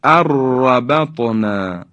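اربطنا with a long rolled r; slow recitation of arrabatna in اربطنا; nun dropped, doubled ra. Shown as a demonstration. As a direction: trill the joined r in اربطنا.

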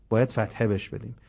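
ordinary talk

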